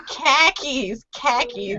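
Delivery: KHAKIS as a Bostonian says car keys; put-on Boston accent, R dropped in KHAKIS; playful Boston-accent imitation; mock Boston accent. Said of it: "the Boston accent".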